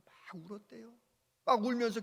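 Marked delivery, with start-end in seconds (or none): 0.1-0.9 whispered aside; hushed whisper about the tears. Acted. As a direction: drop to a whisper. Hushed retelling of crying.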